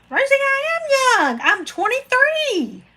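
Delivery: cheeky high-pitched claim of youth; playful squeaky insistence. Pretend. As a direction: squeak it out like a coy young girl. Playfully insisting she is young.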